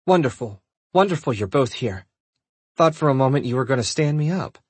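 mildly hammed-up relief, quickening toward the end; dramatic. A narrator's reading. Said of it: "she said dramatically".

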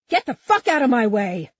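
high, frantic order; angry yell. A narrator's reading. Cop angling shotgun around Huck, angry yell.

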